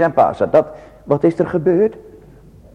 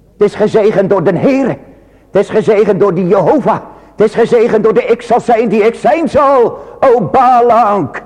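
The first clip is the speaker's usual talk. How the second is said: higher, louder, building proclamation; preaching at full force; 7 semitones higher, 4 dB louder than usual. Acted. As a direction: proclaim the blessing from the pulpit. Preaching climax. (hoger en luider verkondigend)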